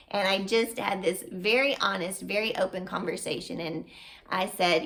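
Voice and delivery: normal talking voice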